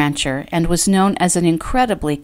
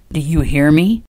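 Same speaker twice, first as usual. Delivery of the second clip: growling; 2 semitones lower than usual. A narrator's reading. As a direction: growl it as a stern warning. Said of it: "he growled".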